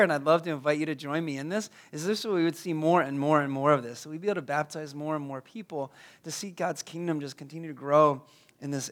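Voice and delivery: ordinary talk, nothing marked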